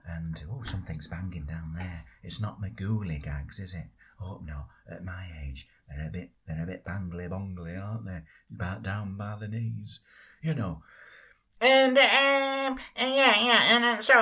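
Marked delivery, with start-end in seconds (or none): 0.0-10.8 put-on very low, half-whispered old geezer; low breathy old-timer grumbling about his age; old character voice. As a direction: creak it out like an old man with bad knees.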